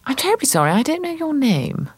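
high, polite posh-lady voice; a high, over-polite hostess, faking friendliness; patronising hostess. Acted. Hostess to a stranger at a gallery party, high fluting politeness. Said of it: "she says".

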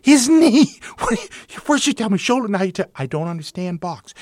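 high, loud squawk of disbelief; exasperated outburst. Retold or imitated. Umpire told it was the knee, incredulous outburst. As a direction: explode in acted indignation. Umpire's outraged reply to manager.

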